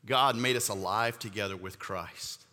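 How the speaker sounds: steady, measured scripture reading; reading scripture to the congregation. Acted. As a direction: read the verses with reverent weight. Reads scripture passage aloud.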